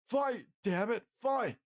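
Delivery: high, drawn-out urging cry; desperate yell. A narrator's reading. Jeff urges dying Bill to fight, yelling.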